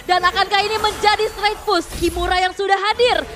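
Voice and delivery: high, loud announcer hype; fighting game announcer. Real, not acted. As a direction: bellow the triple kill like an arena announcer. Caster calls triple kill. (suara announcer game yang heboh)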